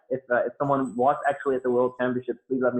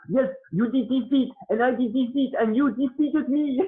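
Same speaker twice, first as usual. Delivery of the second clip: higher, lightly voiced French accent; 11 semitones higher than usual. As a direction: mimic a friendly opponent's French accent. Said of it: "in his French accent".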